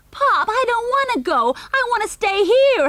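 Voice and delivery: put-on high, loud child's wail; howling. A narrator's reading. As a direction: howl like a spoilt little boy. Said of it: "howled Junior".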